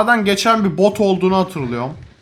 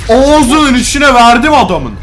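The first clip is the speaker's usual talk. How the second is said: excited; 5 semitones higher, 7 dB louder, slower than usual. Real, not acted. Triumphant shout after a hit.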